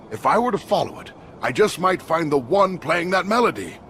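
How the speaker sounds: eager excitement. Acted. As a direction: seize on the idea eagerly. Eager to find the mysterious player.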